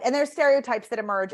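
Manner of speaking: ordinary delivery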